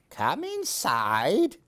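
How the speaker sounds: ordinary delivery, nothing marked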